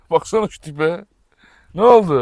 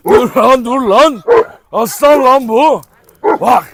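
louder, higher shout to stop the dogs; loud command; 5 semitones higher, 7 dB louder, much slower than usual. Real, not acted. (köpekleri ayırırken bağırma)

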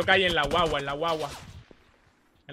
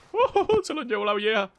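laughing surprise; 7 semitones higher, 5 dB louder, slower than usual. Real, not acted. Reacts with laugh to kill.

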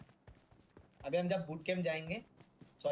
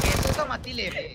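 loud frustrated yell at the screen; 8 semitones higher, 9 dB louder than usual. Real, not acted. Frustrated gamer yelling at enemy.

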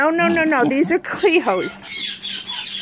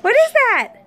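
high pitched; 10 semitones higher, 5 dB louder than usual. Real, not acted. Repeats question much higher.

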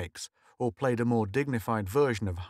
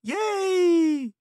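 put-on high, loud kids' cheer; cheering shout; 7 dB louder than usual. Kids cheering Mink's gadget, loud shout. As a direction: whoop it out together. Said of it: "shouted the kids".

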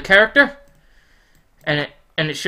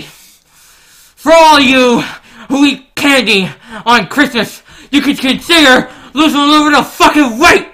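excited taunt; 5 semitones higher, 4 dB louder than usual. Acted. Mock-mean gift taunt at viewers.

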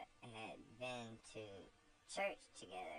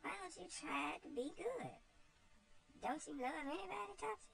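put-on much higher, gentle pleading child; tearful little Eva; faster than usual. Eva pleads with Topsy, high tearful child's voice. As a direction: coax her kindly, close to tears. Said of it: "with tears in her eyes".

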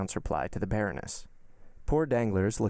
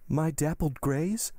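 high, drawn-out incredulous question; astonished demand; 6 semitones higher, slower than usual. A narrator's reading. Baroness springing to the window, astonished demand. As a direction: demand it slowly, pitch lifted in disbelief. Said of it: "demanded the Baroness, springing to the window".

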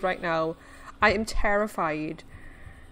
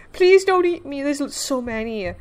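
pitched-up cry for mercy; frightened plea; 3 semitones higher, 4 dB louder than usual. Real, not acted. Pleading with sea creatures.

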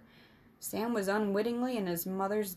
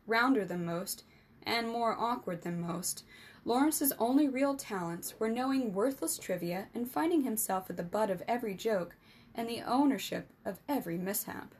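plain reading voice

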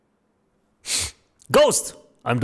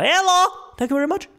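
excited hello; much faster than usual. Real, not acted. Greeting a donor from Slovakia.

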